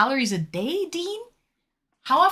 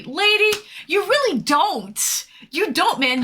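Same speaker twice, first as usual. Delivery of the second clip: playful protest; 6 semitones higher, 4 dB louder, slower than usual. Real, not acted.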